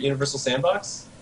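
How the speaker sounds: ordinary delivery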